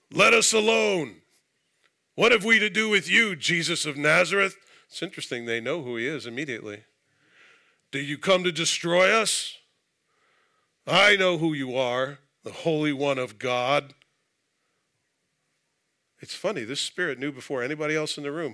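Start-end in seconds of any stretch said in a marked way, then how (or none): 0.1-4.6 2 loud, high, strange unclean spirit voice; 4 possessed man shrieking at Jesus; 1 demon crying out. Acted. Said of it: "And he cried out, saying". 7.9-13.9 2 a hint of the strange spirit voice, louder; 3 spirit taunting and fearful at once; 4 lightly voiced demon confronting Jesus; 1 demon's challenge. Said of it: "And he cried out, saying".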